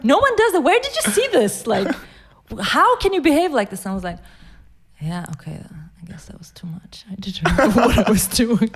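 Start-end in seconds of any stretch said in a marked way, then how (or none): 0.0-3.8 high, loud furious scolding, laughing through it; angry mom. Retold or imitated. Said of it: "was incredibly angry with me". 5.0-8.7 sheepish mumble. Retold or imitated. Scolded child concedes.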